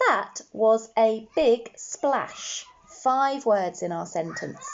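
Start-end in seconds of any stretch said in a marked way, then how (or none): 0.1-2.6 slow repeat. Shown as a demonstration. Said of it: "Let's say our sentence".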